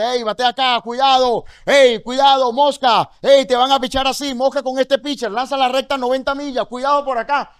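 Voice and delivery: voz alta y aguda de entrenador de béisbol; entrenador animando. Acted. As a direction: alerta al bateador con gritos de ánimo, sin pausa. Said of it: "yo tengo que animarte". (arenga enérgica de entrenador desde la línea)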